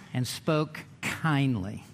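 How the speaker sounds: kind, gentle reading of scripture; spoken kindly. Acted. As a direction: read it as if comforting a brother. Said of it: "Even the tone of his voice".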